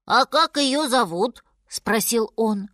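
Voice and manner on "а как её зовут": curious little cub asking, slowly; a child-like character voice, slightly higher; slow curious question from a cub; lightly voiced bear cub.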